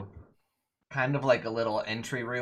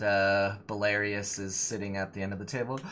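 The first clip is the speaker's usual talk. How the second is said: normal talking voice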